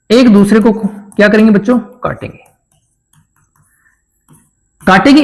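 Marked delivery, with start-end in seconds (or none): 1.8-2.4 a soft, breathy whisper; whispered class answer. Pretend. Teacher voicing the pupils' answer, whispered.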